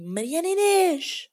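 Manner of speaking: mock mother's shout from afar; mom yelling name. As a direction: call her out like an angry mother from the next room. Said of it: "a minha mãe a gritar por mim".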